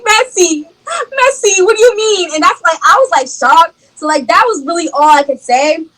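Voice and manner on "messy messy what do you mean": high-pitched, crying re-enactment; tearful appeal. Retold or imitated. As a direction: wail the appeal as if overcome with emotion. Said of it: "I start crying 'cause I'm very emotional".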